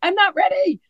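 high, half-crying whine; mock panic. Retelling her nerves to the friend, a high whimpering plea.

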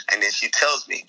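usual speaking voice

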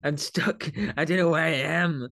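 big hall echo; distant, echoing room sound; echoey. Real, not acted. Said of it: "Sorry if I sound a bit echoey".

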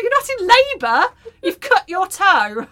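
high, loud incredulous outburst; hammed-up exasperation, slowing at the end; exasperated retort. Mother retorts to daughter over a cut toe, loud exasperation.

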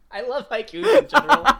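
high, loud, laughing through the words. Real, not acted. Host delighted by a contestant's haiku, laughing. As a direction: crack up while saying it.

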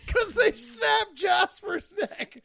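high, loud, drawn-out wail; hammed-up anguished cry; mock-outraged shout. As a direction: wail it out like a distraught fan. Joins in as screaming fan.

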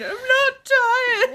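high, slow, tearful whine; childish crying protest. Retold or imitated. Tired child denying being tired, high tearful whine. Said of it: "they're like".

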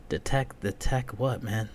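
ordinary talk

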